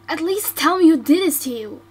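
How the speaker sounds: normal talking voice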